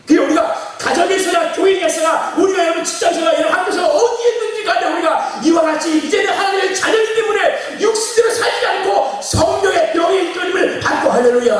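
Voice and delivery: high, loud sustained sermon shout; impassioned pulpit cry; fired-up preaching. Real, not acted. Preaches climax at full force.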